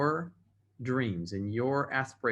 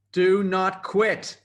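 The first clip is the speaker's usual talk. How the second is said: loud, higher, very slow and deliberate; emphatic command; 6 semitones higher, 7 dB louder, much slower than usual. Real, not acted. Speaker giving the last lesson, loud and slow. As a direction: hammer each word home.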